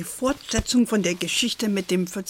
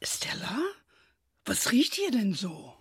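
hushed, suspicious; 7 dB quieter than usual. Acted. Grandma sniffs smoke.

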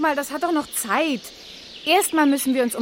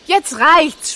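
sharp, louder burst of impatience; fed up; 5 semitones higher, 9 dB louder than usual. Acted. Reporter cutting off the pestering frog, loud and annoyed. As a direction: snap that enough is enough.

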